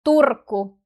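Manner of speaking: "Turku" with a strong trilled r; forceful Finnish trill in "Turku"; stronger R. Shown as a demonstration. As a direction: roll the r hard in "Turku". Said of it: "R sounds stronger than in Tampere".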